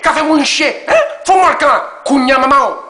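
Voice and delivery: high, loud Martinique French patter; Martinique accent. Retold or imitated. Host riffing as the Martinique De Niro dub voice, high and loud. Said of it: "C'est la voix martiniquaise de De Niro".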